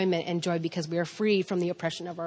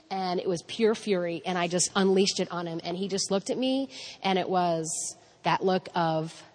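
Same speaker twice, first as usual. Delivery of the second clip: ordinary talk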